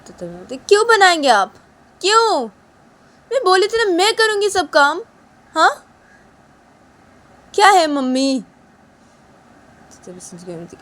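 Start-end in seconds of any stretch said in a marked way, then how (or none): 0.6-8.4 much higher, louder angry scolding; daughter-in-law furiously scolding mother-in-law; angry Uma. Acted. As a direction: shout at her for not listening.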